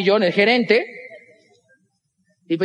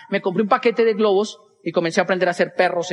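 usual speaking voice

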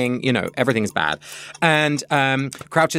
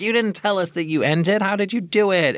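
excited twins; 11 semitones higher than usual. Retold or imitated. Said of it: "the twins are like".

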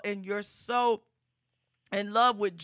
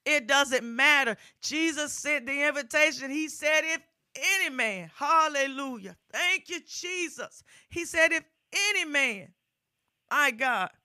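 rising, louder gospel preaching, driving each phrase; full-force preaching; 4 semitones higher, 5 dB louder than usual. Acted. Preacher urging the congregation, full-force preaching. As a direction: preach it out to the whole congregation, building.

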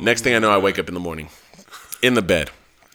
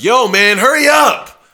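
calling out; 11 semitones higher, 5 dB louder, slower than usual. Retold or imitated. Friend calling out in driveway.

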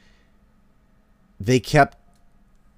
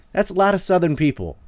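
high, laughing through words; 8 semitones higher than usual. Real, not acted. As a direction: chuckle as you talk. Laughing at own joke.

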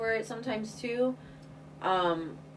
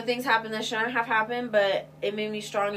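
ordinary delivery

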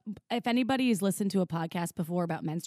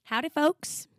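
exaggerated Southern drawl greeting; hammed-up Southern howdy; Southern twang; 6 semitones higher, slower than usual. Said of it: "I'm not gonna talk in that accent".

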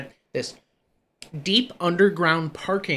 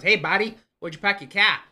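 higher, louder put-on Boston hail; older lady's Boston accent; 6 semitones higher, 4 dB louder than usual. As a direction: imitate a Boston hotel lady, higher and louder. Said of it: "older lady and she goes".

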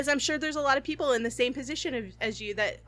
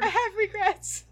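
laughing; 9 semitones higher than usual. Real, not acted. Laughing regret.

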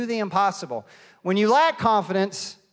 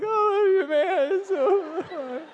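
emotional; 12 semitones higher, much slower than usual. Retold or imitated. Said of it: "And I'm like".

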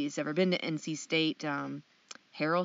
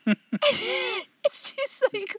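laughing; 4 dB louder, much slower than usual. Real, not acted. Laughing while telling.

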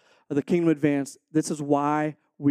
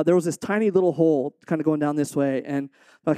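usual speaking voice

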